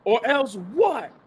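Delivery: slow, high, defiant challenge; Roman set off. Retold or imitated. Roman snapping back at Solo's threat, high and defiant. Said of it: "that set Roman off".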